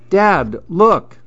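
put-on high, eager child voice; high-pitched excited call; boy calling Dad. A narrator's reading. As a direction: call for attention like a kid. Boy calls dad to look.

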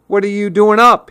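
high-pitched, demanding question. A narrator's reading. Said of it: "he demanded".

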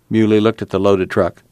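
ordinary delivery, nothing marked

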